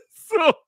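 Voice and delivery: bursts into a squealing laugh, way up high; cracking up, the laugh leaping high. Real, not acted. Host cracking up at his own joke, squealing high laugh.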